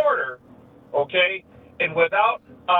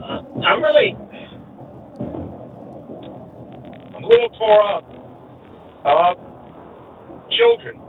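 real emotion, choked up; 5 dB louder than usual. Real, not acted. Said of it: "you can tell I'm emotional".

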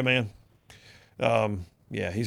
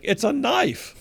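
higher, incredulous retort; exasperated mock retort; 6 semitones higher, slower than usual. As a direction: act out the obvious point with disbelief. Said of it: "I'm like".

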